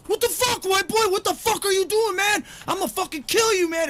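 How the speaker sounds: high, loud mock threat; imitating angry gangbangers. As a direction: ham up the thugs yelling at him.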